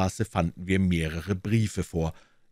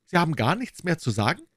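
inspector questioning; 10 semitones higher, faster than usual. A narrator's reading. Inspector Hopkins pressing the suspect, sharp higher question.

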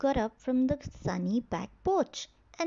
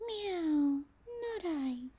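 snooty bored cat; 6 semitones higher, 4 dB quieter, slower than usual. Acted. Cat refuses in story.

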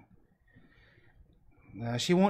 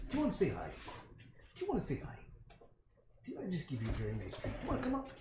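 much higher, softer cooing to a pet; 5 semitones higher, 6 dB quieter, faster than usual. Real, not acted. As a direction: coax the puppy up sweetly and gently. Baby talk to the dog.

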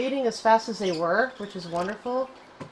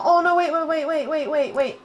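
a touch of high, louder flustered stopping; sudden alarm; 7 semitones higher, 5 dB louder than usual. Real, not acted. Gamer stopping a misclick in a flustered rush. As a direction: scramble to stop a mistake.

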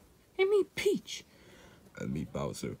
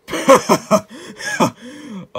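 loud, high burst of laughter; mocking laugh; 11 semitones higher, 10 dB louder than usual. Bowser scoffing at who he can easily take on, mocking laugh. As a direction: laugh them off loudly.

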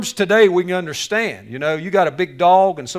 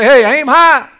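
high, loud slogan call; big, high-pitched rallying cry; rousing recruiter shout; 8 semitones higher, 13 dB louder, slower than usual. Acted. Acting out Air Force recruiting slogan.

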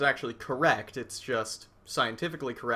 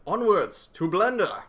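grand proclamation; 4 dB louder than usual. Pretend. Grand send-off to Blender.

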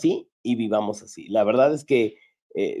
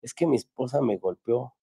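low voice; 6 dB quieter than usual. Retold or imitated. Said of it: "hasta en voz bajita".

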